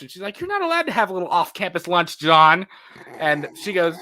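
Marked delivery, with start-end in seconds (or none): 0.4-2.7 quicker scold, the name snapped out loud; scolding Karen. Retold or imitated. Mom scolding John for the off-campus lunch, Karen-style. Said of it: "got really Karen right there".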